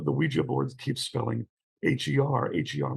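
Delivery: ordinary talk, nothing marked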